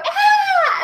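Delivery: high, loud mock baby cry; imitated baby squeal. Woman imitating how babies react to Papa Bear, high squeal. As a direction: let out a baby's squealing "ah". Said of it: "and they go".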